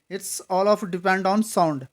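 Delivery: ordinary talk, nothing marked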